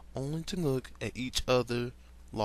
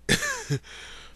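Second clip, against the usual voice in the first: very high squeal of laughter; high shrill giggle; shrieking laugh. Real, not acted.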